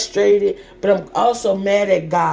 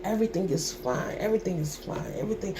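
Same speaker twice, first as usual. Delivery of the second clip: quiet repetition; 11 dB quieter, much faster than usual. Retold or imitated. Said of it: "I kept saying".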